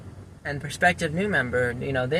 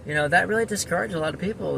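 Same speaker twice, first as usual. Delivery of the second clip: usual speaking voice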